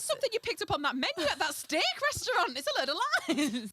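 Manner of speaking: loud, high, laughing protest; incredulous. Real, not acted. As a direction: protest loudly, cracking up. Laughing protest at made-up saying.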